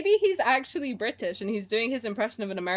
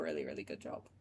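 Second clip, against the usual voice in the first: teasing whisper; 13 dB quieter than usual. Acted. Teasing aside about the player.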